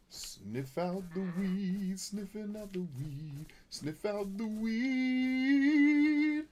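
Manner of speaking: high, slow, stretched-out crooning; odd, high sketch-comedy refrain; crazy dude sketch character. Acted. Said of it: "that's actually a Jamie Foxx line".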